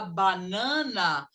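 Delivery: "banana" split into full ba-na-na; separated syllables. Shown as a demonstration. Teacher demonstrates the error to students, "banana" syllable by syllable. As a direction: give each syllable of "banana" its whole vowel. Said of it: "você está separando as sílabas das palavras".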